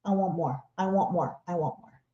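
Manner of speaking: low, hushed imitation of a demanding baby; greedy baby. Baby at mealtime begging for food, hushed greedy imitation. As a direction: repeat it softly and insistently, like a hungry baby. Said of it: "suddenly that baby's palms were up".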